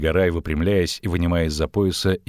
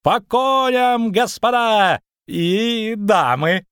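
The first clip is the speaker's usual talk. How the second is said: very high, loud, slow shout; hoarse battle cry; 13 semitones higher, 5 dB louder, much slower than usual. Acted. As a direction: bellow the call to mount up. Calling everyone to ride.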